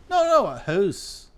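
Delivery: high, slow insisting house; insistent house. Shown as a demonstration. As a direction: correct them, drawing out house. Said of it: "They say house different".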